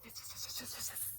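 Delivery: soft hissing fizz; fizzing sound. Shown as a demonstration. Showing a child how baking soda fizzes, soft hiss. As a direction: let it fizz away quietly like soda bubbling. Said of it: "It goes".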